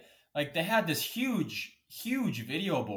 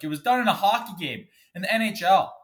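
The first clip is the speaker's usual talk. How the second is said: heated and loud; 5 semitones higher, 6 dB louder than usual. Real, not acted. Angry rant about hockey fine.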